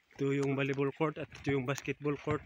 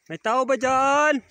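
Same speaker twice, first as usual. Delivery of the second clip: calling out; 8 semitones higher, 8 dB louder, slower than usual. Real, not acted. Calling out for anyone home.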